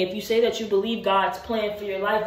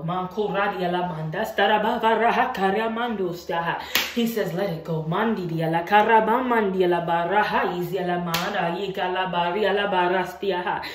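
murmured prayer in tongues; speaking in tongues. Real, not acted. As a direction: pray in tongues, steady and intense.

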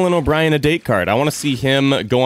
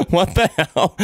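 higher, laughing disbelief; real laughter; 5 semitones higher, slower than usual. Real, not acted. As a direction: crack up while saying it. Cracks up as both fall asleep.

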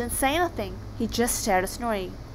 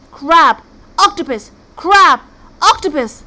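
loud, high back-and-forth squabble; heated bickering; 6 semitones higher, 11 dB louder, slower than usual. Acted. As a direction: fire each word back louder, like bickering brothers. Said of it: "They were so busy arguing".

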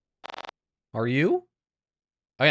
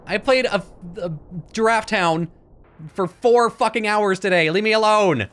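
higher, louder frustrated rant; real exasperated outburst at the game; pissed off; 7 semitones higher, 4 dB louder than usual. Real, not acted. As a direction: vent hours of gaming anger. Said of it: "I'm pissed".

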